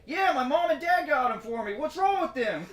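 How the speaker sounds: high, loud, goofy naive voice; mocking neighbor voice. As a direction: play the clueless neighbor, squeaky. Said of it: "He's like".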